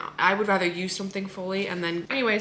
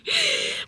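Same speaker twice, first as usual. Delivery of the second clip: high giddy laugh. Real, not acted. Giddy joy.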